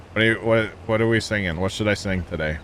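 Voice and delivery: normal talking voice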